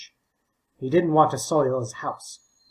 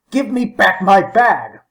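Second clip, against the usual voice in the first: loud, slow, higher angry demand; 6 semitones higher, 9 dB louder, slower than usual. A narrator's reading. Old man shouts at the boy who took his bag, loud slow angry demand. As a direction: bellow for the bag back.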